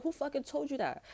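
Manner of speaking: high, incredulous demand; indignant retort. Retold or imitated. Retelling a friend's claim, asking high and indignant. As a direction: act shocked and offended. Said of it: "I was like".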